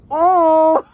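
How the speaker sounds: high, loud shocked "Oh!"; sudden loud high gasp of shock; shocked shriek. Real, not acted. Shocked scream.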